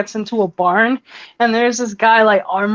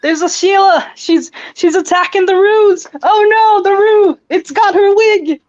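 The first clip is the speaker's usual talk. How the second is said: high, frantic Aussie alarm; much higher put-on Aussie yelling; mock-panicked Australian; 9 semitones higher, 4 dB louder than usual. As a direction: cry out in a hammed-up Australian panic. Acting panicked Australian watching kangaroo fight.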